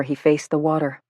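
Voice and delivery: usual speaking voice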